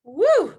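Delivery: a loud high whoop letting emotion out; emotional whoop. Real, not acted. Host releasing emotion after a moving teacher story, loud high whoop. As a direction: burst out with a loud, high whoo to steady yourself.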